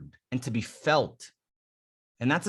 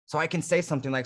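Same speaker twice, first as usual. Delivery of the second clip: ordinary talk, nothing marked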